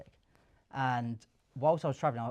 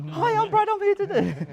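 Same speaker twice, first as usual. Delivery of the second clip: much higher and louder, squeaky with laughter; nervous laughing pitch; 12 dB louder than usual. Retold or imitated. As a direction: blurt the intro in a panicked high squeal, laughing. First door opens, nervous pitch.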